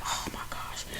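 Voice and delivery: voiceless, hushed aside; whispered shock. Retold or imitated. Reacting to the nursery in the story, shocked whisper.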